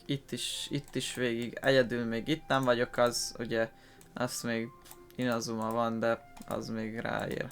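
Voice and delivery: ordinary delivery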